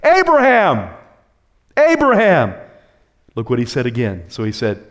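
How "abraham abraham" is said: calling from heaven. Acted. Angel calls to stop Abraham slaying his son, urgent shout. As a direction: shout the name as if across a great distance. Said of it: "called to him from heaven".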